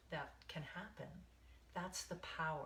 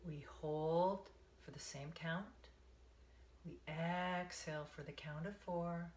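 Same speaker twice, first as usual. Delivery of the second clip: guided meditation. Acted. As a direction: ease them into the breath, one count at a time.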